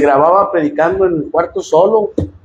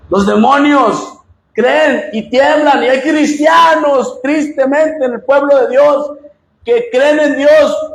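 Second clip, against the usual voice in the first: slow preaching emphasis; 7 semitones higher, slower than usual. Acted. Sermon climax.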